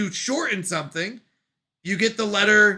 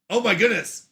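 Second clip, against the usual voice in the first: normal talking voice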